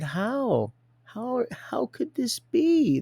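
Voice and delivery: high, slow mock astonishment; a camp, drawn-out "how could this be"; hammed-up disbelief. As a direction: overplay your shock. Mock disbelief in retold exchange.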